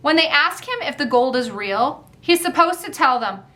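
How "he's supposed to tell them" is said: ordinary delivery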